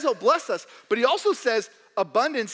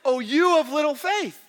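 lightly voiced lofty Jesus; 5 semitones higher, 5 dB louder than usual. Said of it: "Jesus has the nerve to say".

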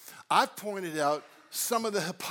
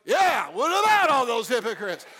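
louder, a buddy's hearty agreement; Jesus chiming in loudly beside you; agreeing loudly; 2 semitones higher, 6 dB louder than usual. Pretend. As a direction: join in like a teammate. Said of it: "sitting beside you going".

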